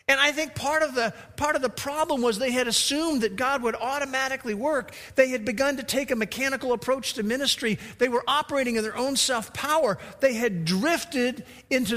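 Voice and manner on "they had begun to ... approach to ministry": ordinary talk, nothing marked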